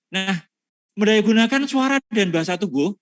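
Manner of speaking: ordinary talk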